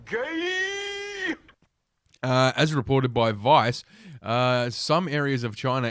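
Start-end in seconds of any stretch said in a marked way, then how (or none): 0.1-1.3 high, long-held jeer; very slow, high-pitched heckle; drawn-out mock cry. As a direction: stretch the word out on one high note. Jeering interjection mid news item.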